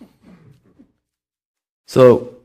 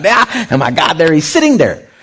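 retelling an excited, higher, louder gasp of starstruck disbelief; freaking out; 7 semitones higher, 5 dB louder than usual. Retold or imitated. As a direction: gush like a starstruck fan spotting a celebrity. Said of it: "all just freaking out".

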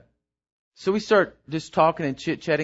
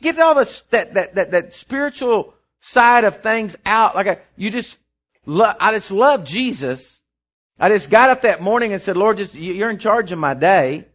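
fervent preaching; 7 semitones higher, 7 dB louder than usual. Acted. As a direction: preach it out to the congregation. Preaching at full force.

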